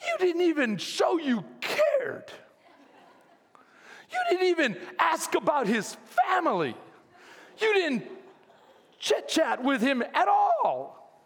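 wife scolding. Retold or imitated. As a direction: keep voicing the appalled wife's lecture. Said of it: "and she goes".

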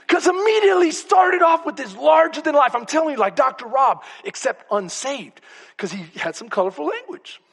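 ordinary delivery

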